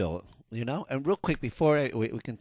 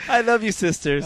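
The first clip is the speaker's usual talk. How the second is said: laughing; 5 semitones higher, 4 dB louder than usual. Real, not acted. Laughing after tease.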